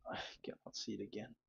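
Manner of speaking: barely voiced, near-whispered mutter; whispered aside to oneself; muttering to self. Real, not acted. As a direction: mumble it under your breath, very softly. Muttering to self while searching.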